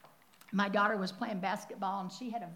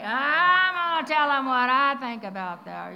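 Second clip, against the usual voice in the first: loud, indignant threat; a mother fuming after the coach's call, loud and indignant; mock angry outburst; 3 semitones higher, 13 dB louder than usual. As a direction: blow up like you're about to call and rant. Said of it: "You ever felt that way?".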